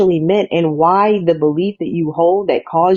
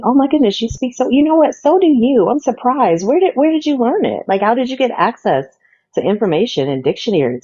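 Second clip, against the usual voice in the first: higher, sarcastic fake amazement; lightly mock a condescending compliment; mock surprise; 6 semitones higher, faster than usual. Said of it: "just as surprised at their accomplishments".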